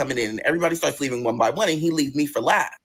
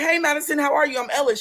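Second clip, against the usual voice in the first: high, bright hello; bubbly greeting; 11 semitones higher than usual. Retold or imitated. New server meeting Madison, high bubbly hello. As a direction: greet her sweet and eager.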